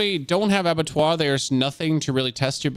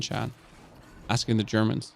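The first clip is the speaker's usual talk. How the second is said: ordinary delivery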